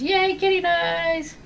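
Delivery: high, slow, gleeful fake cheer; mock cheer. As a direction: draw out a hammed-up yay. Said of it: "Elton says".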